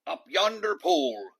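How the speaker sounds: high, loud cheer; crew shout. A narrator's reading. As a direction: shout it back to the captain. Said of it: "the others shouted".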